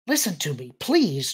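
cutting in, lifting on "please"; a mildly impatient plea. A narrator's reading. Young alien cuts into their talk, impatient plea. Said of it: "cut in impatiently".

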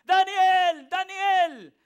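loud, high calling of Daniel's name; shouting down into the pit. A narrator's reading. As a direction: call his name across the den. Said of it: "quiere decir que gritaba".

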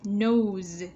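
NOSE ending on a clearly voiced Z; Z ending sound. Shown as a demonstration. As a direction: let the Z finish "Nose". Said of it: "Z ending sound".

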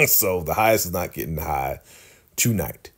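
ordinary delivery

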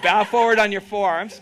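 projected yoga cue; loud cue. Real, not acted. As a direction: call it out over the room. Cue repeated louder then plain.

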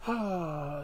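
usual speaking voice

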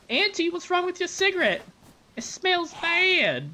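put-on high, slow little child asking; squeaky child voice, drawn out; kid voice. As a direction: play a small kid pointing it out.

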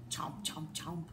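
low breathy chomp, chomp; munch it like a hungry rabbit; whispered munching noises. Acted.

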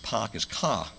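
put-on dropped R's, Northeast accent. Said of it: "Because they stole your R's".

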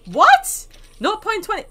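high-pitched, disbelieving outburst; astonished squeal at the figure; real surprise. Real, not acted. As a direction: burst out loud, then question it high. Surprised at amount of gold.

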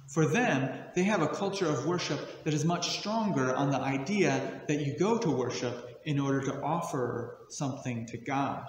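normal talking voice

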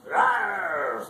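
loud playful roar; dragon roar. Acted. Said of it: "I'm a mean dragon".

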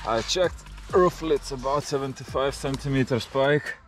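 ordinary talk, nothing marked

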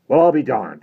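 high, loud exclamation of surprise; exclaiming priest. A narrator's reading. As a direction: burst out in loud amazement. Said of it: "exclaimed Father Higgins".

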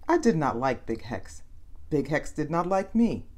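usual speaking voice

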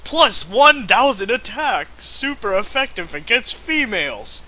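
mock game announcer.

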